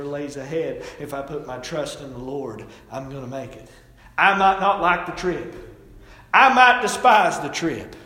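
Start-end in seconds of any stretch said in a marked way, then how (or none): 4.2-7.9 emphatic. Acted. Sermon emphasis.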